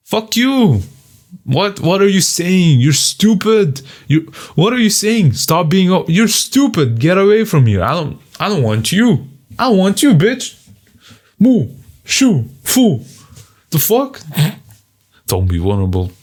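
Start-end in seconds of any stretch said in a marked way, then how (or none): 0.1-10.7 mocking jeers. Voicing mocking people. 11.4-14.2 mock shooing.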